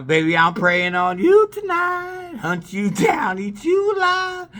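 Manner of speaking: slow, rising to a high cry on eat you alive; predator threat. Retold or imitated. Telling a girl he'll hunt her down, slow menacing then high cry. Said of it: "I said".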